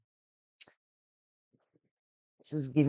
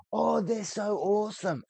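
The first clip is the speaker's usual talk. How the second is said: high, mocking gush; high, sarcastic impression of gushing reviewers; 11 semitones higher than usual. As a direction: sneer at the reviewers in a high, silly voice. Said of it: "Bloody Angry Joe".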